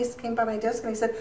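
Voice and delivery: ordinary delivery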